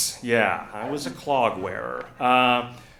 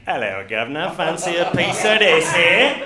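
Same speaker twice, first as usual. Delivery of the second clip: hammed-up British "governor" accent, louder at the end; mock British accent; 3 semitones higher, 7 dB louder than usual. Wife leans in frisky in bed, mock British accent. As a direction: put on a silly British accent. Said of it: "little British accent every once in a while".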